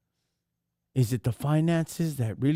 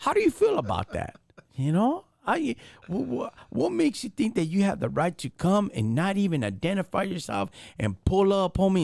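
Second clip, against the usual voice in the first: higher, heated street grilling; mock interrogation; 7 semitones higher than usual. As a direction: pepper him with questions, voice up. Role play grilling the agent.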